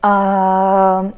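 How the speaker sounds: drawn-out filler draining the breath; long exhaled "um". Shown as a demonstration. Teacher shows fillers waste breath, long "um". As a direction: let air pour out on "um". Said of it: "that's a lot of exhale".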